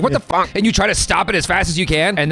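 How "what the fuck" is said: higher, alarmed outburst; startled imitation of the victim; mock shock. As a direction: panic as files vanish. Victim of fake deletion prank.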